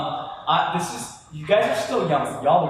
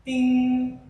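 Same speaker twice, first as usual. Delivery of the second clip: ding sound; 8 semitones higher than usual. Retold or imitated. Imitated phone notification.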